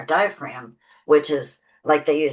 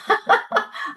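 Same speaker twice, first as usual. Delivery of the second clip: a short breathy laugh; quick airy laugh. Real, not acted. Laughs at covid brain joke.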